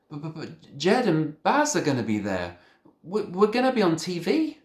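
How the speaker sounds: desperate protest. A narrator's reading.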